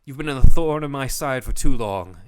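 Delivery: loud, booming villain taunt; villain boast. Retold or imitated. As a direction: snarl it like a gloating villain. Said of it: "he actually is just like".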